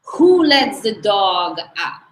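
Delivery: put-on retelling an exercise-class complaint in a Hungarian accent; Aggie's Hungarian accent. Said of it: "one more quick Aggie impression".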